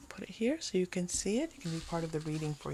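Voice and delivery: hushed aside to the viewers; whisper. Real, not acted. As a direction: drop to a whisper, intimate. Tarot reader whispers to viewers.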